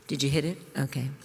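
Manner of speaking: ordinary talk, nothing marked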